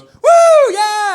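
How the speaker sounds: high, loud whoop of mock enthusiasm; cheering heckler. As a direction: imitate an overexcited tourist whooping from the crowd.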